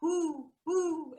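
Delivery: high hooting owl call; high, repeated owl hoots to the parents. Retold or imitated. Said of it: "with the owl sound".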